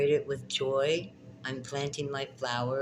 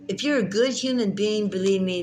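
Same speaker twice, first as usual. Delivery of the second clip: high, loud cheering on; loud encouraging rant to the viewers; fired-up cheering; 7 semitones higher, 5 dB louder than usual. Real, not acted. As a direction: whip the viewers up, urging them to do it.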